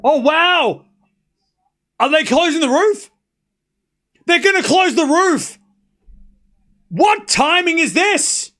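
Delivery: can't believe the timing, slow and high; astonished. Real, not acted. Roof closing mid-match, astonished.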